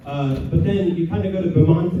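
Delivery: ordinary delivery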